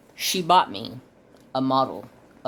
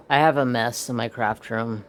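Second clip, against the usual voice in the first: ordinary talk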